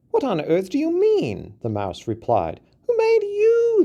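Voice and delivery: high mouse voice, then settling; small mouse challenging; incredulous mouse. A narrator's reading.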